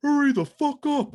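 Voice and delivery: high, loud yell from the bench; imitated yell. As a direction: yell it like an impatient head coach. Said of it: "I hear him yelling at me".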